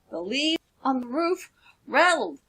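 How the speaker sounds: usual speaking voice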